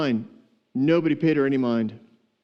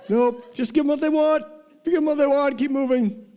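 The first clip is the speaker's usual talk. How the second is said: laughing; 10 semitones higher than usual. Real, not acted. Laughing joke about tough girls.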